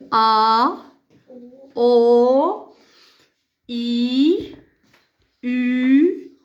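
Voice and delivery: each vowel held long, pauses between; drawn-out vowels. Shown as a demonstration. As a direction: sustain every vowel for the class. Teacher models the vowels.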